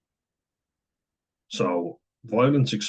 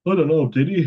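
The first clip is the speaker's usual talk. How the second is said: brother's voice; 8 semitones higher, 6 dB louder than usual. Retold or imitated. Brother's confused reply, retold.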